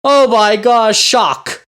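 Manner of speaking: high, loud gasp of fake horror; sarcastic mock outrage; mock shock. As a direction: ham up the pearl-clutching. Mocking outraged conservatives.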